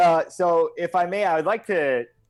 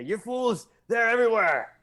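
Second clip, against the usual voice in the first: high, strained cry of alarm; shouted warning; 10 semitones higher than usual. Acted. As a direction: cry out desperately that it's a trap. Character cries out an ambush warning.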